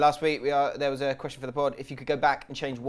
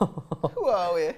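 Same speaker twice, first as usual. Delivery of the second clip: put-on Jamaican accent; 4 semitones higher, much slower than usual. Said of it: "you do Jamaican".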